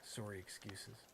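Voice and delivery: quiet Canadian "sorry", rounded o. Shown as a demonstration. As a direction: repeat "sorry" softly the Canadian way. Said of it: "I did a Canadian sorry there".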